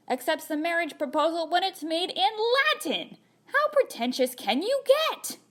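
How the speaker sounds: high, incredulous rant; mock outrage. Letter writer scoffing at a Latin proposal, mock outraged. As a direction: climb in disbelief to an exasperated high finish.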